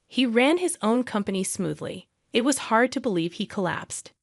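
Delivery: ordinary delivery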